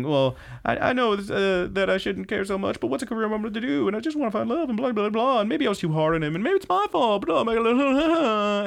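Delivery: high-pitched, hammed-up weepy ramble; tearful mini rant. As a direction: babble on in a mock-crying squeal, ending in a wail. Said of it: "this very quick, like, little mini rant".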